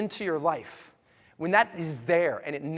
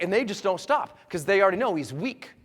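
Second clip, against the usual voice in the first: ordinary delivery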